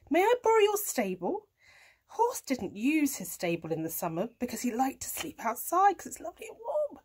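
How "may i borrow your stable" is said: high, quick request as the cat; cat asking. A narrator's reading. Cat asking horse for the stable, high cat voice. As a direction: ask in a lifted cat voice. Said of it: "said Kat".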